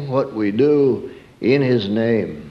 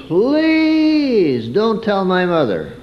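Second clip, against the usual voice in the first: drawn-out, higher pleading plea; pleading child; 8 semitones higher, much slower than usual. Retold or imitated. Boy begging the neighbor lady not to tell, slow pleading. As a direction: beg her like a scared little boy. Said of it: "I pleaded with that lady".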